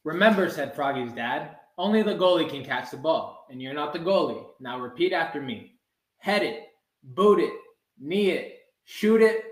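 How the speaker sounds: ordinary delivery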